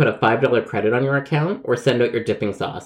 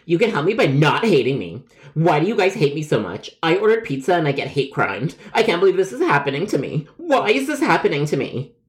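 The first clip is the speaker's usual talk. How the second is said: overly dramatic; 2 semitones higher than usual. Acted. Caller sure the company hates him, overly dramatic. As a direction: plead like a persecuted victim, building in pitch. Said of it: "you yell and are overly dramatic".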